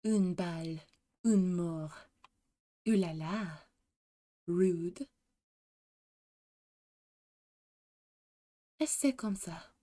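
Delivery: French voice line, understated; French-accented character. Acted. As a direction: deliver each French phrase coolly, spaced apart. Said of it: "how she says it".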